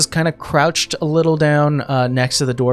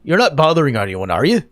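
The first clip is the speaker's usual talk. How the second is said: dwarf voice; 3 semitones higher, 4 dB louder than usual. Acted. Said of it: "Dwarven Man ... goes".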